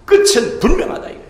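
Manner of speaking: high, loud preaching; closing proclamation. Acted. Pastor declaring God's promise to the church, high and loud. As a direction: declare the victory boldly.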